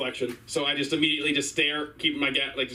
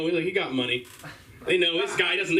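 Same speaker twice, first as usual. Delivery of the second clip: usual speaking voice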